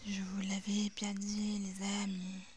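a soft, creepy told-you-so whisper; eerie whisper. Pretend. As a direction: whisper the warning like a ghost. Spooky scene in a horror game. (murmure inquiétant)

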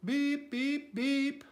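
high repeated horn beeps; urgent beeping. A narrator's reading. Stuck truck calling the farm animals, high beeps. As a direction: beep high like a small truck in trouble. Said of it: "cried the little blue truck".

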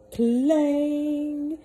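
loud, high mimicked clang of a bell. A narrator's reading. Narrator to listening kids, a loud high bell clang. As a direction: ring it out like a huge clock bell.

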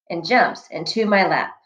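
plain reading voice

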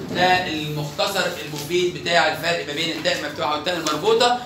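ordinary talk, nothing marked

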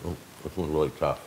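talking with mouth partly full; chewy meat in mouth; chewing while talking. Real, not acted. Seen 1: bites jerky at -7, holds piece, jaw working early on.

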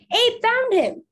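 high, ringing call; repeated shout. A narrator's reading. Another searcher relaying the news through the woods, shouting. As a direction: pass the shout on, high and loud. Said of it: "The shout was repeated again".

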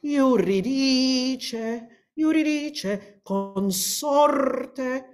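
"Euridice" and "consorte" drawn out slowly, stressed syllable leaning high; long-short lilt. Shown as a demonstration. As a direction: lean into each stressed syllable. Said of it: "lilt of long, short, long, short".

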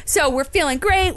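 ordinary talk

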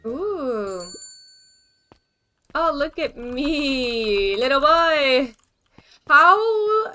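slow, loud cooing; excited cooing. Real, not acted. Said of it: "reacting to photo, drawn out".

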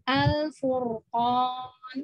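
very slow "Al-furqan", ro held thick; heavy ro. Shown as a demonstration. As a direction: recite "Al-furqan" drawn out, heavy ro.

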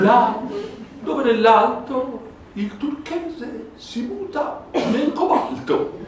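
slow, lofty verse reading; poem recital. Acted. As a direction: declaim it like a poet. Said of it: "Mica male, no?".

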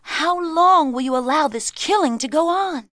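loud, high-pitched protest; impassioned cry. A narrator's reading. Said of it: "she cried".

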